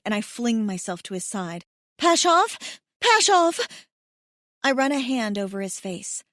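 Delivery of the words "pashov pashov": calling his name high and loud in panic; desperate cry. A narrator's reading. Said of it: "A sob escapes my throat".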